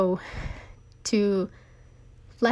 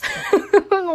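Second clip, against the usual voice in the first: real teary laugh; 13 semitones higher, 6 dB louder than usual. Real, not acted. Laughing off her tears.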